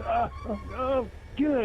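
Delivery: high strained groans; mock groaning. Retelling the prankster in the elevator, high groaning. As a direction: moan it out like a cramping stomach. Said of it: "and then he goes".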